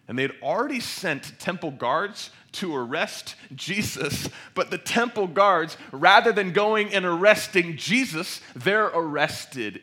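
loud sermon delivery, climbing in pitch; full-force preaching. Real, not acted. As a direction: drive the story forward forcefully from the pulpit.

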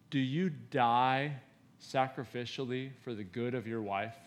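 gently probe, voice kept down; a quiet word in private; gentle challenge. Acted. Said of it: "I try to very gently say".

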